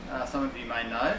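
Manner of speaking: ordinary talk, nothing marked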